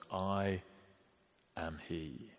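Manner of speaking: very soft and drawn out, almost breathed; hushed, awed. Acted. Preacher quoting Jesus to the soldiers, hushed and awed. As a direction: let the divine name hang in near silence.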